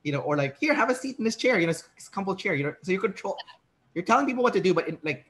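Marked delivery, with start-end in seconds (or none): 0.6-2.5 higher, lightly sweet polite offer; a hint of a gracious host guiding a guest; mock-gentle invitation, higher pitched; nice hostess. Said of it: "in a very nice way".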